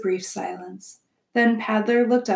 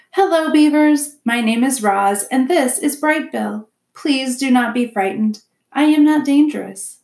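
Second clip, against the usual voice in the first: higher, louder voice for a polite robot; robot reassuring wary strangers in a raised voice; friendly robot; 5 semitones higher, 7 dB louder than usual. Acted. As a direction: greet them as a robot, pitched up and projected. Robot greets the wary beavers.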